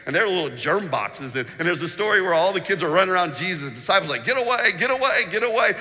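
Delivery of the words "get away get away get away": higher, louder get away, get away; shooing shout. Retold or imitated. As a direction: shoo the children off. Disciples shooing kids away.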